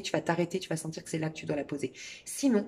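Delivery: ordinary delivery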